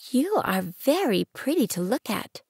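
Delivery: little prince. Acted. Narrator voicing little prince.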